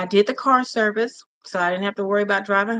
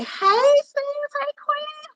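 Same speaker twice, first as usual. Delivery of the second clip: much higher, quick bright hellos; excited greeting; much slower than usual. Real, not acted. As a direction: break off to greet them happily. Greeting viewers mid-story.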